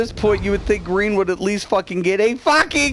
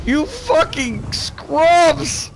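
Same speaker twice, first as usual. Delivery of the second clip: drawn-out taunt; 6 semitones higher, much slower than usual. Real, not acted. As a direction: stretch it out very slowly, higher, gloating. Mocking losing team after the win.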